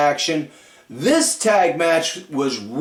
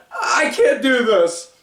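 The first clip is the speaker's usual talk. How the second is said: high, slow, tearful mock line; tearful giving up; 8 semitones higher than usual. Maxine near tears, walking away from Candice. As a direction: imitate her near tears. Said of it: "all sad and, like, choking up".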